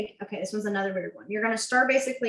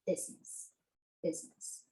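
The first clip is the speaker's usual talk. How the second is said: whispered word; 5 semitones lower, 13 dB quieter than usual. Shown as a demonstration. Mouthing while signing.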